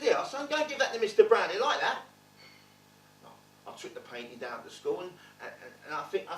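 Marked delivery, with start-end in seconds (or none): 0.0-2.0 higher, louder cockney dad; a dad sending a pointed gift; dad's voice. Retold or imitated. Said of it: "He said".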